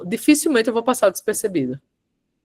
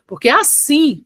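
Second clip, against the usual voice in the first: "assim" punched louder and drawn out; stress on ASSIM; 5 semitones higher, 4 dB louder, slower than usual. Shown as a demonstration. Coach slipping emphasis into her advice, stress on "assim". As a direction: lean hard on "assim", then hold. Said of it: "olha o assim de novo".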